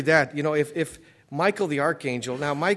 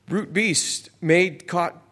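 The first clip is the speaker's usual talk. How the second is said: higher, louder, very slow; slow emphatic quote; 4 semitones higher, 5 dB louder, slower than usual. Shown as a demonstration. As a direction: drag each word out for weight. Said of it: "That's verse twelve".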